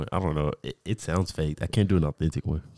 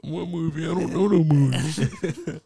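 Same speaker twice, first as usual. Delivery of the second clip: baffled fan; 10 semitones higher, 4 dB louder than usual. Retold or imitated. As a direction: stretch it out like a clueless fan. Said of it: "retelling fan's reply".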